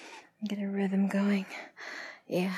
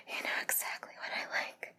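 much softer, voiceless whisper; breathless whisper; 8 dB quieter than usual. Acted. Lover repeating approval to a partner, breathless whisper.

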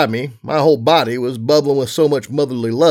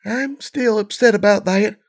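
voice breaking; 9 semitones higher than usual. A narrator's reading. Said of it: "Serenity's voice broke".

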